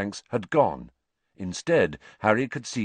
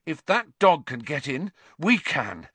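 panting; 6 semitones higher, 3 dB louder than usual. A narrator's reading. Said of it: "Harry panted".

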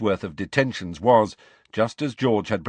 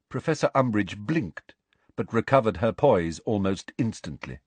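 plain reading voice

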